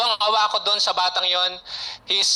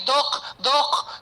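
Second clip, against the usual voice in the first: imitating the mother's excited call; 5 semitones higher than usual. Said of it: "umiiyak po".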